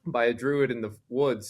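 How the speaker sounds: usual speaking voice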